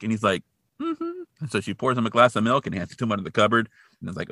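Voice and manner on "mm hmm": tiny high, soft hum; soft squeaky kid voice; little boy. Retold or imitated. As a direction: imitate a small child agreeing meekly. Small boy voiced in cupboard.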